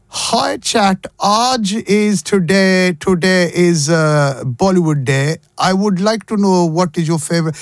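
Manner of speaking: slow, sing-song put-on Indian English accent; mock Indian accent. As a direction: ham up an Indian accent for the chatbot. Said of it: "this sounds really racist".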